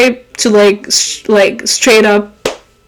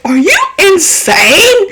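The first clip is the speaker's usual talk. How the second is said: high exasperated mock yell; hammed-up appalled; mock outrage; 8 semitones higher than usual. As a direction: imitate scolding the boss. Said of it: "I would have been like".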